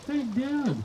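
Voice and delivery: high chirpy daughter on phone. Retold or imitated. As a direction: chirp it like his grown daughter.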